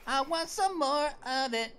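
normal talking voice

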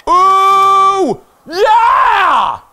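long, high, loud whoop; elated high-pitched holler; triumphant yell. Real, not acted. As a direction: let out a big victory cheer. Celebrates another new record.

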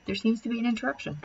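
plain reading voice

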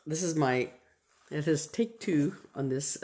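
normal talking voice

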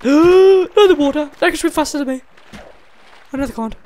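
high loud gasp-led panic; shrill panic. Real, not acted. Gamer panicking at a fast-swimming mob, high and loud. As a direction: squeal in fear.

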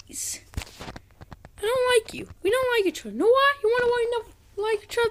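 very high, strained-up complaint; high-pitched upset. Real, not acted. Gamer telling the game they don't like it, high-pitched and upset.

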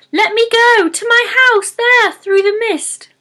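high, loud squeaky little pixie. Acted. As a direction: cry out like a tiny trapped fairy. Pixie trapped in troll's fist.

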